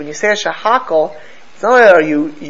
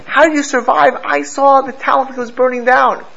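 amazed question; 8 semitones higher than usual. Retold or imitated. Boy asking his family how they lived, amazed and higher. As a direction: sound stunned they are alive.

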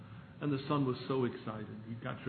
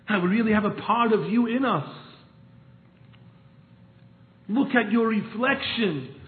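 fervent preaching; 8 semitones higher, 8 dB louder than usual. Acted. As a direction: preach it with full fervour. Preacher climaxes fervent appeal.

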